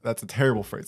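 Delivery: usual speaking voice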